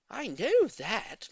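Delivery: slow, high, firm; slightly impatient lady. A narrator's reading. Old lady brushing off neighbour's fact, slow firm. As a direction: brush it aside. Mrs Silver voiced.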